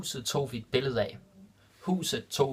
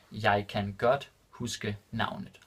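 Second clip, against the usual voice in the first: Jeg kan godt huske navnet said slowly and distinctly; careful slow repeat for students; slow and clear; slower than usual. Shown as a demonstration. Teacher repeating the Danish example for learners, slow and clear. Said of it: "repeated slower for learners".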